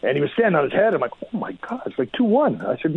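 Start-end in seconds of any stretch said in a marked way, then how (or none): 1.2-2.6 high, surprised gasp of disbelief; can't believe we're winning; amazed disbelief. Retold or imitated. Player marvelling at teammate that they lead, high and surprised.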